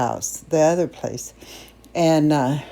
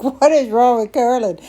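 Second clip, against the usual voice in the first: higher-pitched, imitated family bafflement; mock dismay, pitched up; exasperated relative; 6 semitones higher, 3 dB louder than usual. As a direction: voice a fed-up family asking in disbelief. Family's exasperated reaction voiced.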